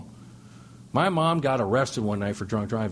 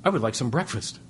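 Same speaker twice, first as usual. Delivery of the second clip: mom demanding breakfast. Retold or imitated. Drunk mom demands breakfast in jail.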